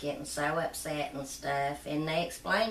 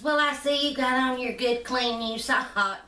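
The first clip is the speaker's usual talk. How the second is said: high and louder, playfully sarcastic; teasing nurse; 6 semitones higher, 5 dB louder than usual. Retold or imitated. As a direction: tease the boy about his socks, voice pitched up. Nurse teasing about the dirty socks.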